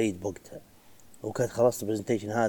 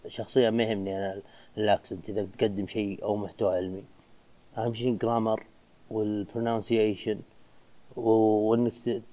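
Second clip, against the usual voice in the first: usual speaking voice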